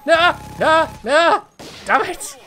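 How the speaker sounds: loud, high repeated no; despairing cries. Real, not acted. Watching the heist fail, loud high cries of despair. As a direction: wail in disbelief. Losing the bag to cops.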